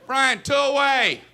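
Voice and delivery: high, slow call across the infield; second baseman calling out. Acted. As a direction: call out insistently, drawing the words out. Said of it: "second baseman would give me signals".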